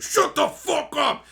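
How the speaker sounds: high, slow, loud angry yell; angry shout. Retold or imitated. Angry moviegoer yelling at the cheering viewer, slow loud high. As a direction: yell at the rude guy behind you, high and loud. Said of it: "He was so mad".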